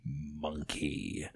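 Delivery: low, slow, drawn-out man from beyond; ghostly man's voice. Acted. Dead grandad prompts the toddler, ghostly low voice. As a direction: prompt softly in a deep ghostly voice. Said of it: "you can hear a male voice say".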